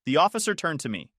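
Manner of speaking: plain reading voice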